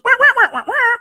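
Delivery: high-pitched, loud woman's wah-wahs; trumpet talk. Retold or imitated. Faceless woman at the deli counter, high trumpet talk. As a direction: imitate a woman as a squealing muted trumpet. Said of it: "he's talking in trumpet".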